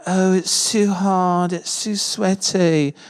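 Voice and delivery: slow, whiny mock-British complaint; a put-on British accent for a whining hiker. Hiker complaining about the sweaty walk, in a mock-British whine. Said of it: "I don't know why she's British all of a sudden".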